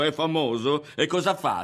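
high, loud mocking questions; derisive, rising challenge; jeering. A narrator's reading. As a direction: taunt them to prove it. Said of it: "rise Berto".

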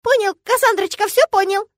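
high, loud call from below; high-pitched, cowed yell; yelled placating reply. Acted. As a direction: shout back in hasty submission. Culprit yells back from downstairs.

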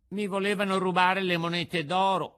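high, loud puppet outburst; alarmed puppet. A narrator's reading. Puppet tells companions of assassins, high alarm. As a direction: announce it loudly as the puppet.

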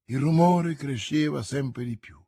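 usual speaking voice

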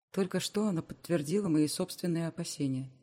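ordinary delivery

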